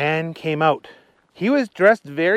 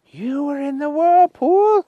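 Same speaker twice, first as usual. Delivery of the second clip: high, slow, surprised policeman voice; Willie surprised; 14 semitones higher, 6 dB louder than usual. Acted. Policeman Willie surprised they came through the whirlpool, high and slow. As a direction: gasp it out as the little policeman. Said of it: "said Willie the policeman".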